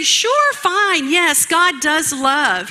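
high-pitched "sure, fine", slowing into a grudging yes; mock concession. The enemy concedes God's love to win trust, mock-agreeable. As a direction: play the deceiver pretending to agree. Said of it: "And he would say".

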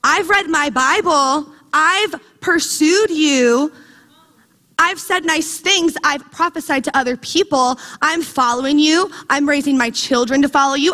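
indignant, pleading list of good deeds; insistent proclaiming. Acted. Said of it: "He's just proclaiming over and over".

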